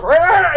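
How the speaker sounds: high, loud mock monster roar with a laugh. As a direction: roar like a pouncing creature. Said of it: "And the Gazer goes".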